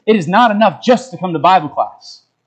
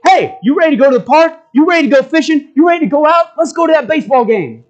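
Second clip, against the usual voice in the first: put-on upbeat, high, fast dad inviting his kids out; casual dad; 8 semitones higher, faster than usual. Preacher voicing a father inviting his children, excited. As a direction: sound like an eager father planning a fun Saturday. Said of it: "the other father ... says".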